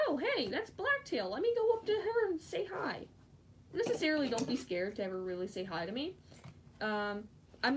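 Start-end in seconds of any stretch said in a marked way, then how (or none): 0.1-3.1 high, swooping fan voice; imitated eager fan. Fan spotting them at a con, high imitated excitement. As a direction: pitch it up like an excited onlooker.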